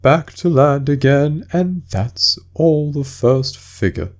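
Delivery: high, slow, sobbing turtle voice; a weepy sea creature struggling through tears; tearful Mock Turtle. Mock Turtle speaking to Alice, high and tearful.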